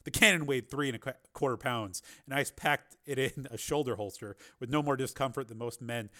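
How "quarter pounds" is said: usual speaking voice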